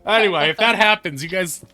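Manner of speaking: high, loud, eager voice; eager excited NPC. Acted. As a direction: gush like an excited fan.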